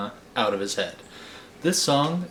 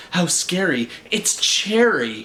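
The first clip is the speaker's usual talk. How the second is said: mock shiver; 5 semitones higher, 3 dB louder than usual. A narrator's reading. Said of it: "he thought with a shiver".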